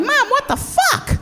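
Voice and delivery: put-on very high, drawn-out child's squeal; little girl voice. Comic as little girl shocked at mom's necklace, squeaky child voice. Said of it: "I was a little girl".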